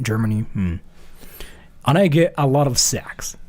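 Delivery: usual speaking voice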